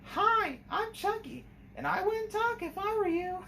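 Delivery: put-on very high, sweet girly voice; little girl voice. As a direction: play a little girl with a threat behind it. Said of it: "does the little girl voice".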